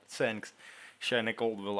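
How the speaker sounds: usual speaking voice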